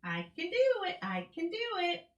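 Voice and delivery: high squawky parrot repeating. Acted. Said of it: "The parrot says".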